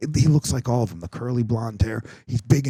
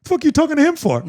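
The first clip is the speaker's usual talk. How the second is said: imitating the kid, much higher and blunt; blunt kid's retort; 3 dB louder than usual. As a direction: mimic the boy's blunt snap, pitched up high. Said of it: "he turns to the camera and he goes".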